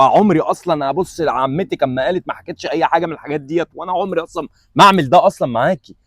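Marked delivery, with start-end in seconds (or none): none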